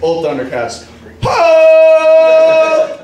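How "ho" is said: loud, high, long-held shout; battle cry. Acted. Comic cheering the old Thundercats show, battle cry. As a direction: holler it like a cartoon hero's call.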